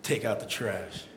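a low muttered echo of the nag. Retold or imitated. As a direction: grumble it low and soft. Repeated quiet mock.

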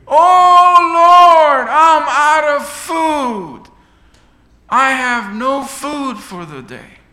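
high, loud, slow cry to heaven; loud pleading prayer. Acted. Old lady cries out to God that she has no food, slow loud plea. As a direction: drag each word out as a lament. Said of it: "a different form of praise".

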